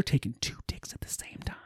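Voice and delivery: hushed, mock-shocked aside; scandalized whisper. Subscriber marvelling at the escalation, scandalized whisper. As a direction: drop to a whisper mid-rant.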